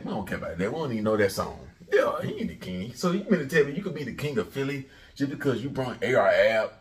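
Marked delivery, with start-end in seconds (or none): none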